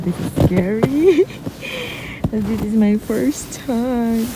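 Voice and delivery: high, nervous, shaky laugh; scared giggly voice in snow. Real, not acted. First walk in deep snow.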